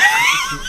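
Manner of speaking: shrill laughing burst; high squeal of laughter. Real, not acted. Laughing at bing bong.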